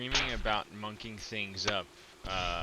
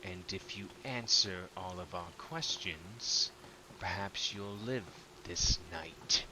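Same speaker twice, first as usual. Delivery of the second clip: slightly twanged captor; 4 semitones lower, 6 dB quieter than usual. Acted. Hooded figure threatening a captive at knifepoint, slightly twanged menace. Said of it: "a slight, slightly twanged".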